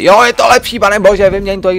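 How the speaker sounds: high, loud mock shout at himself; mock chat yelling. Streamer mimicking chat yelling at him, loud mock shout. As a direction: ham up the viewers yelling to swap it. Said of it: "že na mě řvete".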